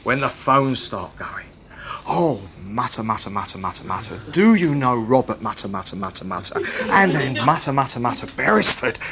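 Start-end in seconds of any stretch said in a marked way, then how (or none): 2.1-9.0 low gossipy phone mumble; mock muttering. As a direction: grumble furtively, only names popping out. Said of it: "we're back to the infamous phone calls".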